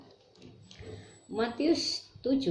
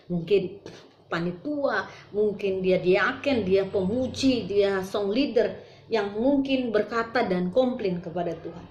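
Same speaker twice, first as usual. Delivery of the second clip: usual speaking voice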